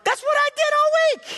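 very high, loud comic screech; exasperated wail. Retold or imitated. Preacher on the phone to youth pastor, exasperated screech. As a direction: squeal it in outraged protest.

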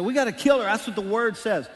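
angry accusers. Acted. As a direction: push hard for the stoning. Accusers demand stoning.

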